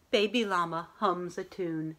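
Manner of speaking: plain reading voice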